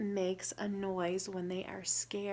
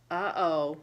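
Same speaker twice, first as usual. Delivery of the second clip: whimpering; 4 semitones lower, 7 dB louder than usual. Acted. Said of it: "whimpered Tortoise".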